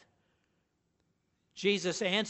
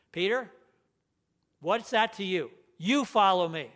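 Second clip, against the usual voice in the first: You follow me with You louder; stress on You; 3 dB louder than usual. Retold or imitated. Preacher voicing Jesus to Peter, stressing You. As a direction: hit You, let follow me fall. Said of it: "that's my emphasis".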